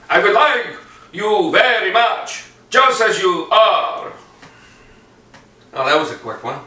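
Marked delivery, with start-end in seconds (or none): 0.1-4.1 high, loud theatrical vampire voice, slow; hammy old-world vampire count; Count Dracula. Retold or imitated. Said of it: "Count Dracula".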